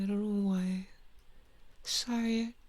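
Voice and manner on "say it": lightly hammed Australian drawn vowels; mock Australian accent. Streamer repeats the Twilight line, mock Australian accent. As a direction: quote it in a dubious Australian accent. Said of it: "they don't actually sound Australian".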